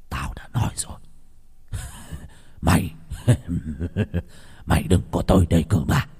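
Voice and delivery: low, sinister throaty chuckle between words; chuckling possessed voice. A narrator's reading. Possessed Tú warning the monk off, throaty chuckling. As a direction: start whispered, then warn through eerie low laughs. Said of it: "cười khùng khục trong cổ họng".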